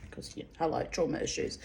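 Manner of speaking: unvoiced, very quiet wry aside; breathy whisper aside. Real, not acted. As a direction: drop to a whisper for the confession.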